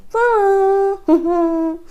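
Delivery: high, sung-out doorbell chime; imitated doorbell. Husband rings the bell at the front door, high imitated chime. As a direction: mimic a bell with long high notes. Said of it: "He made".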